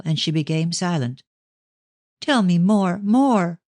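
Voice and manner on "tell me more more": urgent plea, lightly pressed; mild excited begging; eager cry. Acted. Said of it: "cried Ruth, springing towards her".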